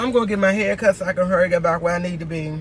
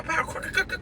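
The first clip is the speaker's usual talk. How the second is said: high, soft popping sound effects; imitated noises; 6 semitones higher, 11 dB quieter than usual. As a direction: mimic pops and clicks in a light, high voice. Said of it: "and someone go pow".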